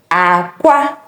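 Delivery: "Aqua" low, then rising; egg tone. Shown as a demonstration. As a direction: start "Aqua" low and jump up on the second syllable. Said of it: "you have to listen attentively".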